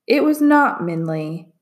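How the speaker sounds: usual speaking voice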